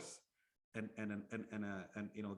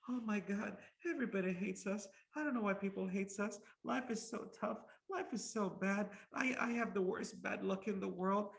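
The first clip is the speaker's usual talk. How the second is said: higher, complaining, self-pitying whine; whiny victim; 7 semitones higher than usual. Retold or imitated. Mocking pessimistic people who complain life is bad, in a high whine. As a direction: moan like everyone is against you. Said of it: "victim mentality people".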